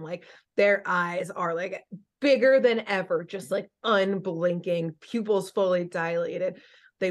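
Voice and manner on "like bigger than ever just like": usual speaking voice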